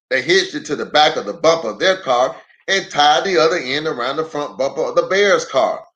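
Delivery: ordinary delivery, nothing marked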